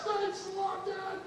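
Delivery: put-on high happy kid voice; excited child. Son thanking his father for a car, high excited voice. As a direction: thank your dad like a thrilled child.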